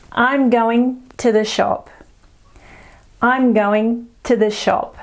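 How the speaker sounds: slow "I'm going to the shop" with "to" and "the" as schwas; slow, squashed "I'm going to the shop"; schwa demo. Shown as a demonstration. Teacher demonstrating the schwa in "to the". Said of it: "I want you to try and hear the schwa sound".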